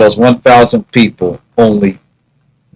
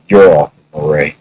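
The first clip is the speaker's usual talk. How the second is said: Joker impression; 9 semitones lower, faster than usual. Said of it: "and he's like".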